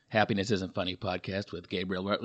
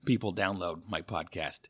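usual speaking voice

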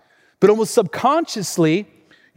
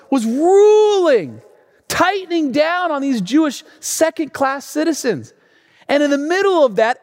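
full-force preaching; 9 semitones higher, 3 dB louder than usual. Real, not acted. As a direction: raise the voice and press each phrase.

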